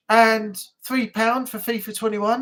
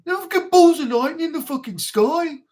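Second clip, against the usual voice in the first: [name] impression; 6 semitones higher, 4 dB louder than usual. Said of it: "[name] impression continues".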